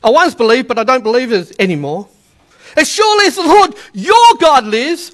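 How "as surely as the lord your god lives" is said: "your" rising, higher and sceptical; disillusioned widow, edged "your"; bitter doubt. Shown as a demonstration. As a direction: say it as someone who no longer believes. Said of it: "because God hasn't shown up".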